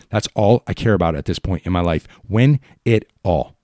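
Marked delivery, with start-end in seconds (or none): 2.3-3.6 slow and weighty, each word set down; slow, insistent words to the team; slow, deliberate demand. Real, not acted. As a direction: lay it out slowly like a mantra. Repeated demand, slower first take.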